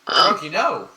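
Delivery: loud, high-pitched yell; shouted command. Acted. Player calling Croaky off, urgent shout. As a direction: shout to stop the creature at once.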